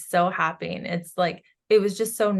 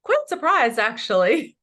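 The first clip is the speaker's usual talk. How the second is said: mildly surprised quote; 5 semitones higher, 5 dB louder than usual. Retold or imitated. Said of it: "he's like".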